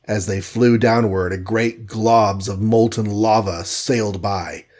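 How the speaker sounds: usual speaking voice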